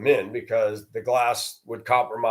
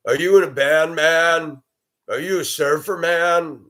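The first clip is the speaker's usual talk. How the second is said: higher, teasing surfer-dude questions; mock dude voice; 6 semitones higher, 4 dB louder than usual. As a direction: parody people who judge his long hair. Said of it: "they make their little".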